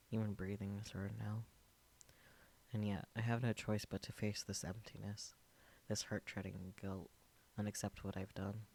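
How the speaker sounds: normal talking voice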